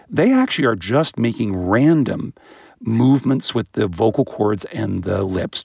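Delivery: usual speaking voice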